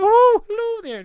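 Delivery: very high, loud hooting falsetto; Mrs. Doubtfire. Acted. Attempting the film nanny's greeting, high whooping falsetto. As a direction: sing out the greeting in an old lady's falsetto. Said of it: "to me, that's Missus Doubtfire".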